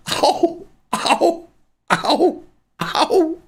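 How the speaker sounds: high pained yelps. Real, not acted. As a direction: yelp from the cramp. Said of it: "I'm in pain".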